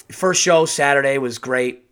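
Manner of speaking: ordinary delivery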